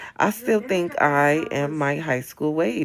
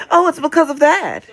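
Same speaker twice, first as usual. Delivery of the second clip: mock know-it-all; 13 semitones higher, 10 dB louder than usual. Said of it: "And they say".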